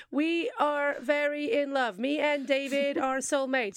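slow, stilted mock recital; reading off a script. Co-host mocking a girlfriend's interview quote, slowly reading off a script. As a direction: imitate someone woodenly reading out prepared lines. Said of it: "She's just reading off".

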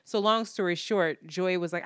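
ordinary talk

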